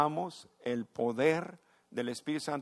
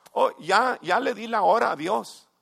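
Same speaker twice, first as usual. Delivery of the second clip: high, louder dismissive mock voice; mocking churchgoer; 7 semitones higher, 8 dB louder than usual. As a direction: poke fun at the lazy believer. (burla de feligrés rutinario)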